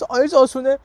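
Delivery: high, loud mocking disbelief; incredulous doubters. Students laughing at teacher's claim, incredulous question. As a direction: echo the sceptics with a jump in pitch.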